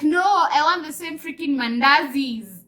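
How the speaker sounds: loud, high mock tantrum; indignant retold outburst. Pregnant woman refusing her partner's replacement mandazis, indignant outburst. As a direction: protest loudly, pushing the pitch up. Retells angry demand to partner.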